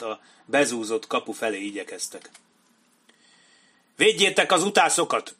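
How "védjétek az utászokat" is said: high, loud battlefield command; bellowed order. A narrator's reading. Kenny orders cover for the sappers at the gate, bellowing. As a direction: yell it over the gunfire. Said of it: "üvöltötte Kenny".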